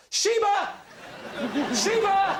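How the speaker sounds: panicked shout. Retold or imitated. Panelist reenacts calling a pretend dog, panicked shout. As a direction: call out for a dog in mock panic. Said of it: "and I shout".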